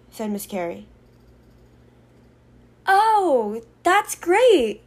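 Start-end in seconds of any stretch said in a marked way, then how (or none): 2.9-4.8 high, loud put-on delight; fake happiness. A narrator's reading. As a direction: overdo the joy to hide dismay. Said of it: "faking her happiness".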